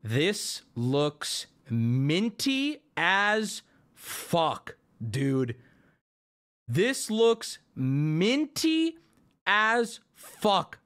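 very slow, word by word; slow, savouring wonder; awed admiration. Real, not acted. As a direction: draw out every word in amazement. Awed inspection of the card.

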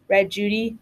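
ordinary delivery, nothing marked

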